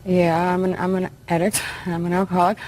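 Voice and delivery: usual speaking voice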